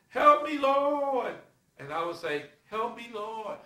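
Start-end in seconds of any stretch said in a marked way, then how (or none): 0.1-1.3 loud, high drawn-out plea; crying out for help. Acted. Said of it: "You will say". 2.7-3.6 mocking imitation. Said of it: "He's marking you".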